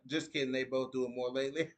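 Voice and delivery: ordinary delivery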